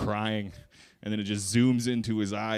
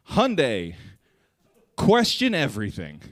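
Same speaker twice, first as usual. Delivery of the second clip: louder, higher slogan read; mock ad slogan; 4 semitones higher, 8 dB louder, slower than usual. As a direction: announce it like a dramatic ad. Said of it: "and it just said".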